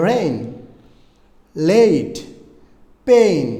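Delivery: "রেইন", "লেট", "পেইন" with a stretched glide; long ay vowel. Shown as a demonstration. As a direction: punch out each word slowly, falling away. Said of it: "দিয়ে আরও আমরা উচ্চারণ শিখতে পারি".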